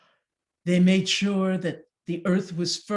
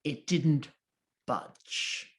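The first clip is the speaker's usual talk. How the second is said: plain reading voice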